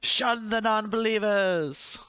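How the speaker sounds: high, drawn-out yell of a zealot knight; krzykliwy okrzyk. Acted. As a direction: yell it high and long like a battle cry. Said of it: "he's gonna start yelling".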